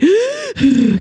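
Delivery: one sudden high gasp for air; a tight-throated gasp; sharp gasp. Shown as a demonstration. Showing the meltdown breath, a sharp gasp. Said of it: "kind of gaspy".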